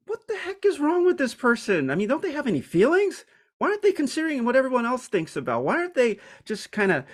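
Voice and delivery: high, fast, exasperated inner outcry; indignant disbelief. Retold or imitated. A timid person silently fuming at a blunt speaker, high and exasperated. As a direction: huff at how anyone could be so blunt. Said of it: "And you're like".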